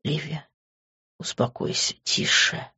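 hushed whisper, barely voiced. Grant hushing crying Liv in a soft whisper. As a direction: whisper soothingly to calm her.

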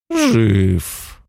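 even, crackly remark; crackly giant voice. Acted. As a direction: say it flat and crackly. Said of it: "ровно заметил он трескучим голосом".

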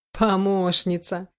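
slow, drawn-out scornful echo; bitter mockery. A narrator's reading. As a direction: stretch the word out in bitter disbelief. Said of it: "смех был горьким". (горькая насмешка, протяжно)

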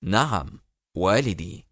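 low-pitched, understated resolve; measured, decisive low answer; very firm. A narrator's reading. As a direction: confirm it firmly, pitched low. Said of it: "أجاب في حزم أكبر".

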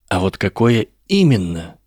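plain reading voice

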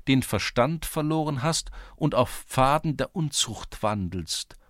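high-pitched official recitation; clerk reading aloud. A narrator's reading. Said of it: "Der Schreiber hüstelte und begann vorzulesen". (hohe, amtliche Vorlesestimme des Schreibers)

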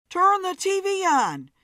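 high, loud demand; urgent shout. A narrator's reading. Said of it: "stopped talking to catch his breath".

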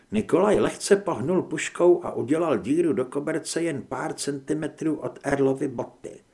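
ordinary delivery

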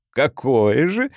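gurgling alien with a forced smile; strained grin in the voice. A narrator's reading. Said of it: "расплываясь в неестественной попытке улыбнуться".

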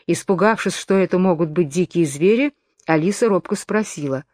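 ordinary delivery

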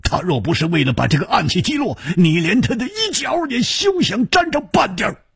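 high, fast, cutting retort; scornful rebuke. A narrator's reading. Swordsman telling the youth he stood no chance, scornfully. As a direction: rush into it and climb high in scorn.

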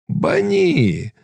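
high-pitched Cossack outcry; crowd calling out. A narrator's reading. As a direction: call it out high over the crowd. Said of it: "загукали запорожці".

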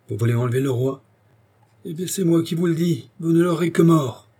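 rising to a high, forceful challenge; defiant exclamation. A narrator's reading. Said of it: "s'écria-t-il en marchant sur monsieur". (défi lancé, de plus en plus haut)

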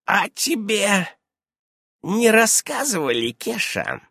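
high, deliberate, pausing question; slow, pensive witch, dropping at the end; slow and thoughtful. A narrator's reading. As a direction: draw it out while pondering. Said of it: "медленно и задумчиво проговорила она".

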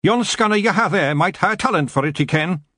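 high, broad Scottish Feagle brogue; a high Scots pictsie voice; Scottish pixie voice. Acted. Feagle speaking in story.

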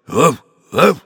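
loud barking woof; dog bark. A narrator's reading. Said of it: "ревел басом милорд".

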